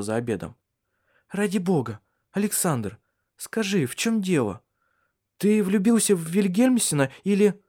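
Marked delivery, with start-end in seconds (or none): 1.3-7.6 higher-pitched woman's voice, pressing; narrator's higher voice for a pleading woman; anxious Cecilia. A narrator's reading. Woman pressing Александр over dinner, higher and insistent.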